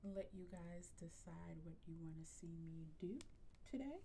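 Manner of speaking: ordinary talk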